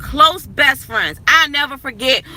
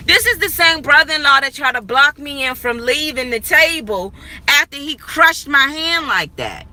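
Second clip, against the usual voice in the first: ordinary delivery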